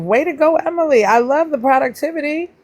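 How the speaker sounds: high-pitched cheer; enthusiastic praise. Real, not acted. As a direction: cheer them on in a lifted voice. Cheering on a viewer.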